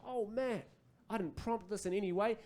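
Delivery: thrilled, keeping the volume down; excited dad. Acted. Dad delighted at his son's new word, high hushed excitement. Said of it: "And I got excited".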